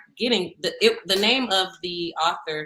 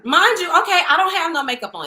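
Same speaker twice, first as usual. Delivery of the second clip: higher and louder, indignant; indignant outburst; 10 semitones higher, 8 dB louder than usual. Real, not acted. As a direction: protest loudly that you had no makeup on. Indignant about no makeup.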